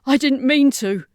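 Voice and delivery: loud, high, pained protest; guilty outburst. A narrator's reading. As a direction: blurt out the guilt. Carleen after causing destruction.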